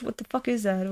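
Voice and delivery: ordinary delivery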